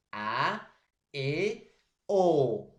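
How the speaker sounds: slow A, E, then a loud O; open vowel demo. Shown as a demonstration. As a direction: spell out each open vowel to the students. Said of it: "Mire cómo lo estoy pronunciando".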